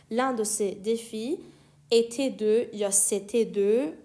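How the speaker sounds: slow, deliberate dictation; slow model reading. Shown as a demonstration. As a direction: lay each word out slowly. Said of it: "teacher repeats slowly".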